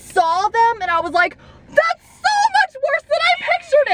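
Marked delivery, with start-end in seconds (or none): 1.7-3.9 very high shrieking outburst; retold scream. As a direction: scream it like the monsters just appeared. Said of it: "I literally screamed that".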